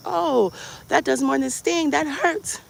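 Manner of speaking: high, drawn-out reaction to pain; pained protest. Retold or imitated. Patient telling doctor the injection hurts, pained voice. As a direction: stretch it out high as it stings.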